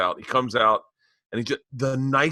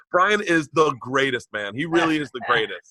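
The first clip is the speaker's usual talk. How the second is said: ordinary talk, nothing marked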